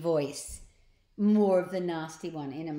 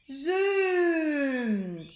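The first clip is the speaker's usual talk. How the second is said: zoom; 9 semitones higher, 6 dB louder than usual. A narrator's reading. Rocket noise.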